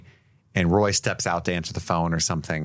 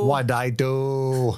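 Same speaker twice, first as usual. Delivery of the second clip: much higher, drawn out very slowly; mock dismay; 4 semitones higher, much slower than usual. Co-host echoing the killer's line, high and very slow. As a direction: stretch the whine of a guilty killer.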